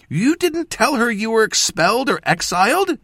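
high, loud accusing question; demanding. A narrator's reading. Apprentice confronting mentor over a secret, demanding. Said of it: "she demanded".

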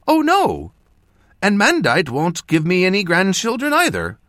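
high, doting mother voice; fond mother. A narrator's reading. Mother calling to her son across the room, doting and high. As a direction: call out sweetly like a proud mother.

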